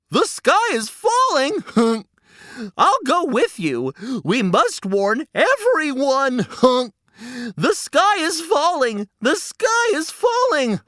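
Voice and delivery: an eager goose caught up in the panic; excitable goose. Acted. Goosey Lucy joins the panic.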